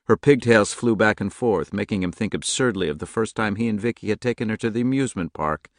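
ordinary delivery, nothing marked

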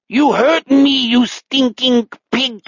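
high, slow, angry alien German; drawn-out pseudo-German outrage; outer space German accent. Acted. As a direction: wail the accusation in a high mutant German accent. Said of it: "some kind of outer space German accent".

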